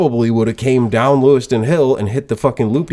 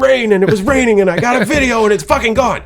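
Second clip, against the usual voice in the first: high-pitched retold wail, piling up the losses; devastated meltdown; 12 semitones higher than usual. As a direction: replay a worked-up, inconsolable meltdown. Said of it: "miserable ... devastated".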